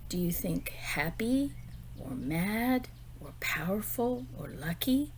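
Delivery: normal talking voice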